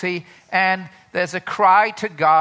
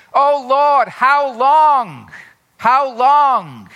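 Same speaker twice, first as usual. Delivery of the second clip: loud, high plea to God; pleading cry of lament; 5 semitones higher, 6 dB louder, much slower than usual. Acted. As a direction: cry out in anguish. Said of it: "it comes like this".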